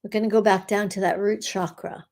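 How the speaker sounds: guided meditation. Acted. Healer guiding listeners through a meditation, calm and steady. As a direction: lead the group gently through the energy work. Leads a curse-clearing meditation.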